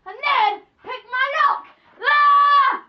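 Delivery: loud shout rising to a high cry; frustrated yell. Acted. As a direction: yell at the stuck lock. Frustrated boss yelling at lock.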